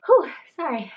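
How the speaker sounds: breathless exhale then apology; out of breath. Real, not acted. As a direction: blow out a puff of air. Catching breath mid-sentence.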